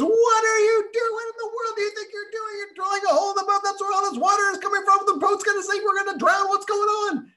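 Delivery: very high, fast, loud frightened shouting; high, rushed, near-crying alarm; frantic panic. Acted. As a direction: scream at your friend as water pours in. Friend sees the drill, panics.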